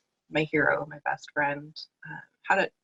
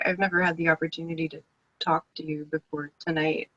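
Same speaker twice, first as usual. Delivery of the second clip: normal talking voice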